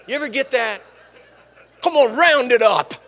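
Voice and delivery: high, loud comic outburst; mock exasperation. Preacher teasing congregation over tithes to the cent, mock exasperated. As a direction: build to a booming, exasperated punchline.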